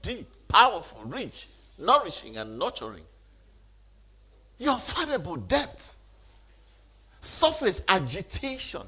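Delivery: high, loud declamation in separate bursts; soaring sermon delivery; impassioned preaching. Real, not acted. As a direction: proclaim each phrase to the congregation, high and forceful. Preaches at full force.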